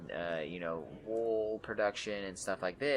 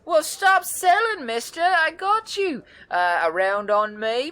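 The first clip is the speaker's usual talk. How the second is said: very high, loud, bubbly woman's voice; excited red-haired woman; 10 dB louder than usual. Acted. Wiry red-haired woman greets the adventurers, very high and thrilled. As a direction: bounce in thrilled to meet them. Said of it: "Mister Barkeep said".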